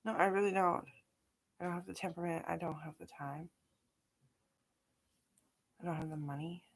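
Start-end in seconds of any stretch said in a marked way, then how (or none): 1.6-6.6 muttered repeat. Real, not acted. Same reasons repeated, quieter.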